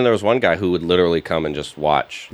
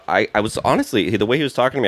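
normal talking voice

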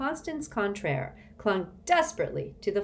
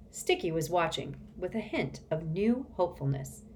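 usual speaking voice

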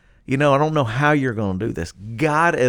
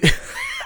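sudden squealing laugh, very high; burst of high laughter. Real, not acted. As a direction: laugh out in delight. Laughs at John's reply.

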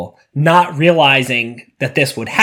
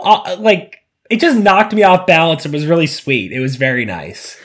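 ordinary talk

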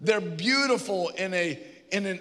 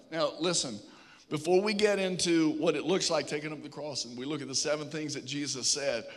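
ordinary talk, nothing marked

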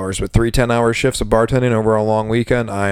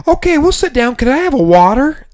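much higher, needy tourist voice; whiny customer; 4 dB louder than usual. Retold or imitated. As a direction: beg for water like a helpless tourist. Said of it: "customers at the bar".

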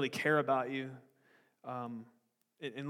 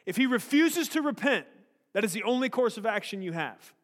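stern rebuke; 7 semitones higher, 8 dB louder than usual. Retold or imitated. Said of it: "He says".

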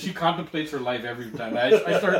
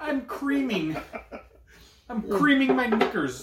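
mock fangirl; 7 semitones higher, slower than usual. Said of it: "he almost did an accent".

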